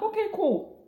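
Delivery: breezy high okay, cool; high mock okay. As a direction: toss it off in a high sing-song. Sarcastic okay after foreign instructions.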